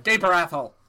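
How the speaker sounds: loud, lightly voiced Peter Griffin; a hint of cartoon dad barking orders. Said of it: "doing a Peter Griffin impression the whole time".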